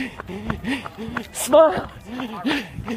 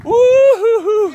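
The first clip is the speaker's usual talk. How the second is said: loud, high, drawn-out woo-hoo; excited whooping; 10 semitones higher, 5 dB louder, slower than usual. Real, not acted. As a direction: whoop in triumph at the finish. Crossing the finish line.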